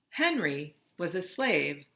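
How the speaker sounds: usual speaking voice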